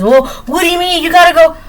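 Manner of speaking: a boss demanding the missing order. Retold or imitated. Boss scolds employee about lobsters.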